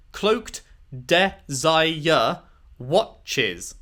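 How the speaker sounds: "cloaked desire watches" spoken very slowly, syllable by syllable; counting syllables. Shown as a demonstration. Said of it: "is six".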